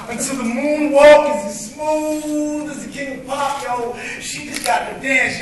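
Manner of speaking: rhythmic spoken word performance, building and falling; spoken word poem. Acted. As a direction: perform it like a slam poem on an open mic stage.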